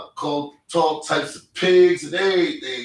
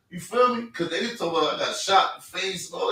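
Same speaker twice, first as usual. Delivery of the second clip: ordinary talk, nothing marked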